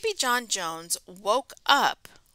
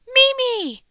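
high, loud bright hello; excited greeting; 12 semitones higher, 8 dB louder, slower than usual. Real, not acted. Host greeting young viewers, excited and high. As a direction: announce yourself with a big lift.

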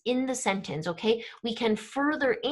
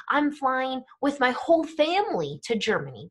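"whole family" emphasized by the voice; stress on whole family; 2 semitones higher than usual. Shown as a demonstration. Teacher showing how English uses voice, stress on "whole family". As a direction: punch "whole family" as the key detail. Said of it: "We use our voice in English".

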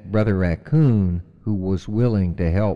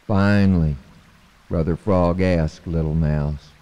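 plain reading voice

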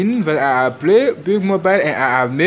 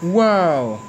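normal talking voice

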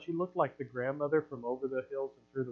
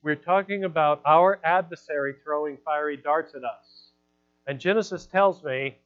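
loud, driving sermon delivery; preaching at full force; 10 dB louder than usual. Acted. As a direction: preach it out to the whole church. Preaches loudly to congregation.

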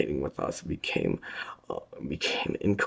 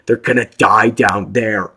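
loud, higher yell; shout; 8 semitones higher, 15 dB louder than usual. A narrator's reading. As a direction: shout it at the guard. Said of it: "I shouted".

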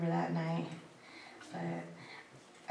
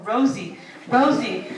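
louder and louder "Rosie"; father catching the culprit, rising volume; mock accusing call; 10 dB louder, slower than usual. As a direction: call out the prankster. Dad discovering pink nails.

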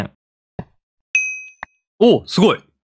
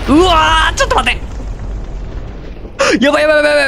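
panicked excitement; 11 semitones higher, much faster than usual. Real, not acted. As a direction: shout it out as things go wrong. Panicked reaction in game.

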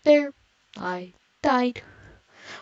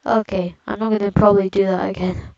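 ordinary talk

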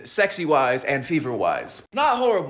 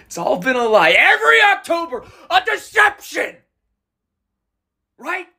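much higher, loud, shrieking betrayal; hysterical shout; 13 semitones higher, 7 dB louder than usual. Acted. To the barista about October lies, hysterical shout. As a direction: scream it like the world has ended.

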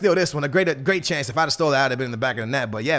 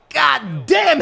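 usual speaking voice

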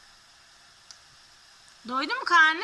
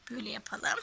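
whispering; 6 semitones lower, 7 dB quieter, faster than usual. Real, not acted. Mother whispers during play.

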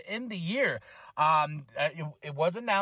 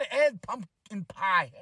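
normal talking voice

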